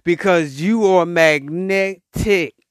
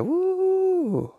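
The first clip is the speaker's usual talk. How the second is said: mock amazed woo; 12 semitones higher, 5 dB quieter than usual. Reacting to chatty strangers.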